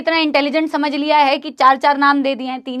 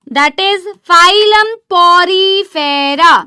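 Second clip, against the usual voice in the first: slow stressed naming; 2 semitones higher, 5 dB louder, much slower than usual. Shown as a demonstration. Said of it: "phylum porifera".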